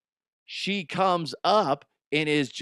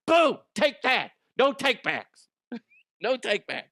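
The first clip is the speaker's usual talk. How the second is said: triumphant boom; 10 semitones higher than usual. Pretend. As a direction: gloat like you just scored. Triumphant taunt.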